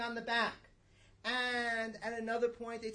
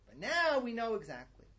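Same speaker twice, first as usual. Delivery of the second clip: a louder, pointed now we know exactly; smug mock certainty; 3 semitones higher, 6 dB louder than usual. Mimicking experts claiming total knowledge, smug. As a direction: sound falsely sure of yourself. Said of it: "And, you know".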